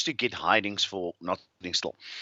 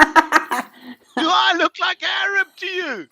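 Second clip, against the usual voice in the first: much higher, louder disbelieving question; squeaky high disbelief, half laughing; incredulous; 6 dB louder than usual. Retold or imitated. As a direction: pitch it way up in mock outrage. Indignant retold disbelief.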